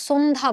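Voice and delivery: সন্ধ্যা with a dental s, "sondha"; wrong s sound. Shown as a demonstration. Teacher showing what we don't say, wrong s sound. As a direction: start সন্ধ্যা with a plain s. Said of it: "দন্ত স দিয়ে".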